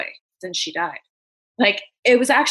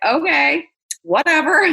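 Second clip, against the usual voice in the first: casual bravado; 7 semitones higher, 5 dB louder than usual. Retold or imitated. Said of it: "I was like".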